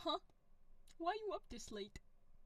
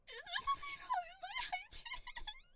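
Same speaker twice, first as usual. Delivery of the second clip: much higher squeaky challenge; high pitch. Pretend. Streamer answers chat's jab about her voice, squeaky. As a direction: squeak it on purpose, daring them. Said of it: "There's that high pitch again".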